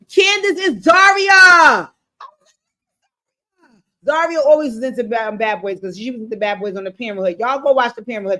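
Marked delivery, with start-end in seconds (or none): 0.1-1.9 slow, loud, high exclaim of a mix-up; suddenly catching her own mistake out loud; surprised realization. Real, not acted. Talking to viewers, she realizes the name mix-up, slow loud exclaim.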